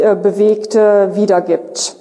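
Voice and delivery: ordinary delivery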